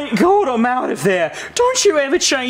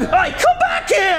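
shouting; 12 semitones higher than usual. Acted. Frog chases them.

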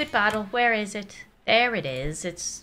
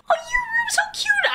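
very high, squeaky cooing; gushing squeal. Real, not acted. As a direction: squeal with delight at something adorable. Gushing over the baby's room.

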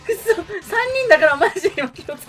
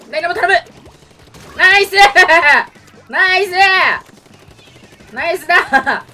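excited shout; 10 semitones higher, 14 dB louder than usual. Real, not acted. Cheering teammate in match.

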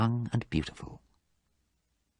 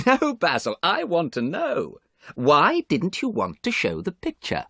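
high-pitched, amused insistence; lightly acted amusement; laughing; 11 semitones higher than usual. A narrator's reading. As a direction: press him with a touch of laughter. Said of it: "Dorian laughed now".

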